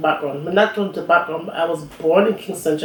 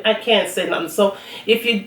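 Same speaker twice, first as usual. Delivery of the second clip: ordinary delivery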